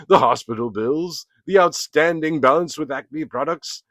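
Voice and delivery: pompous, theatrical cartoon villain voice, high and loud; grand, self-pitying cartoon coyote, declaiming; Wile E. Coyote voice. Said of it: "I was trying to do the pompous voice that Wile E. Coyote had".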